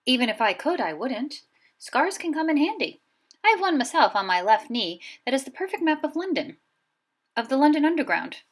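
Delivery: put-on a wry old headmaster sharing a joke; old wizard. A narrator's reading. Dumbledore to McGonagall about his scar, lightly amused.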